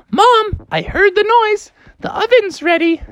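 high, eager call; high-pitched excited Froggy; calling to Mom. Acted.

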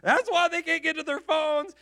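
husband picturing disaster, rising panic; freaking out. Retold or imitated. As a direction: spiral into panic about the accident. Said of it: "I am literally freaking out".

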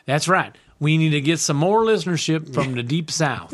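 light mock Southern accent. Cohost joining in with a light mock Southern accent. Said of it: "you're not having fun talking in the accent anymore".

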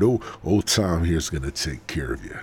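ordinary talk

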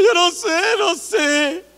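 a touch of crying in the voice, pitch up a little; tearful reply. Retold or imitated. Someone asked why they cry, answering tearfully. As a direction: answer half in tears, mildly.

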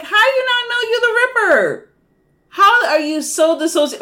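high-pitched exasperated disbelief; incredulous outburst. Acted. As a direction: voice shoots way up in mock outrage. Mock outrage at the character.

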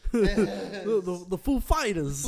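slow, halting, laughing Walken voice; Christopher Walken impression. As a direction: stammer [name] like Walken. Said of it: "Oh, great impression".

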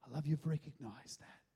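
soft, low, accepting reassurance; hushed, low, acted forgiveness; tender voice of God. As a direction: answer a confession gently and quietly. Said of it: "God goes".

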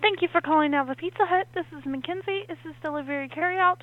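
over the phone. Real, not acted. Pizza employee answering phone.

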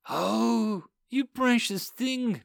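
put-on possessed girl cooing to the boy. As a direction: coo it like a mocking demon. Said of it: "Lisa cooed".

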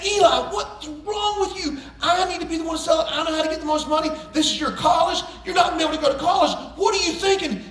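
hammed-up outraged dad; retold furious rant. Dad berating son about selling stuff.

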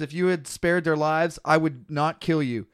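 usual speaking voice

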